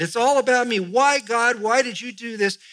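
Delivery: a touch of a whiny Moses, "me" stressed; self-centred whine. Retold or imitated. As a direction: play Moses moaning all about himself. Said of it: "This is Moses speaking to God".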